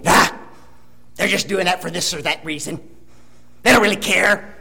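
low, dismissive mock grumble; negative grumbler. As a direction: imitate a discouraged naysayer brushing it off. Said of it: "everything is negative".

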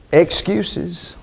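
ordinary talk

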